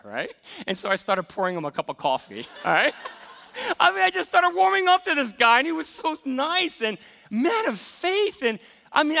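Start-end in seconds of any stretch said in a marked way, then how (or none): 2.6-9.2 laughing, higher and louder. Real, not acted. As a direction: laugh through it with delight. Laughing while recounting warming up.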